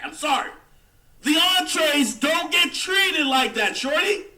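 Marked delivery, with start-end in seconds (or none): 1.2-4.2 higher, louder and slower; "The entrees don't get treated like that" shouted slowly, higher and louder; emphatic shout. Acted. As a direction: hammer the point home, shouting. Repeats the line, louder the second time.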